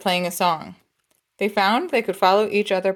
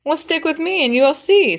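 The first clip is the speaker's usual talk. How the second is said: eager encouragement; 8 semitones higher, 4 dB louder than usual. Acted. Young man encouraging Lee.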